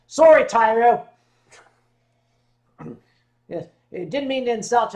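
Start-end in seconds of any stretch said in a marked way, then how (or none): none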